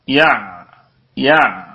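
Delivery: "يع" low, with the ع gently held; easy ayn demo. Shown as a demonstration. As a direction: settle on the ع in "يع" and let it sound. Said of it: "عندك سهولة في مخرجها".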